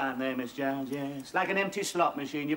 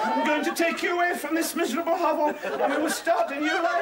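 loud, high, hammy hero; melodramatic lover; 8 semitones higher, 3 dB louder than usual. Acted. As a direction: declaim it like a matinee idol. Acting the love scene.

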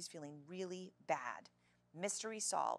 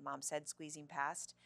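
ordinary delivery

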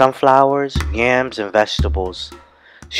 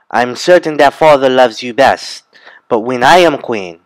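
resentful boast; 3 semitones higher, 7 dB louder than usual. Acted. Jealous sister boasts.

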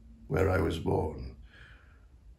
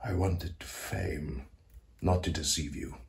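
soft, low confession; hushed confiding; 5 semitones lower, 10 dB quieter than usual. Acted. Composer confesses his desires to ghosts, hushed confiding. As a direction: let the ambition out quietly, with pauses.

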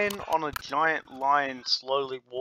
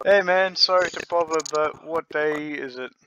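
player character; 3 semitones higher, 6 dB louder than usual. Acted. Player character calling to the tamer, asking what day it is, louder. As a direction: call over to a stranger.

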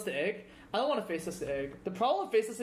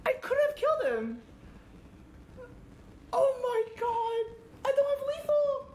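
excited outburst. Real, not acted. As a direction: yell in frantic disbelief. Opponent missed lethal twice.

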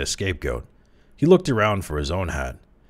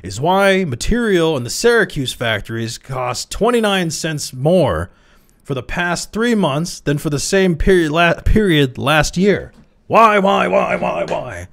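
much higher and louder, rising to repeated whys; exploding; 10 semitones higher, 7 dB louder than usual. A narrator's reading. The furious chairman demands answers from Crowley, shouting. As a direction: hammer each why harder. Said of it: "he exploded".

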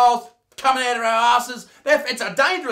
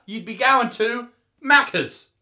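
ordinary talk

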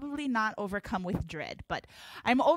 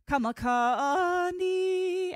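drawn-out call; 7 semitones higher, much slower than usual. Shown as a demonstration. Hula teacher calling dance words.

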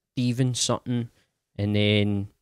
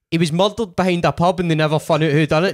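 tag; 6 semitones higher, 5 dB louder than usual. Retold or imitated. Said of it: "And then he went".

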